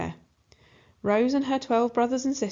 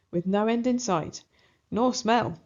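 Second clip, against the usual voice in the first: ordinary delivery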